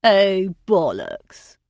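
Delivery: a touch of an exasperated scoff; dismissive. A narrator's reading. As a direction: brush it off with contempt. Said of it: "rolled her eyes".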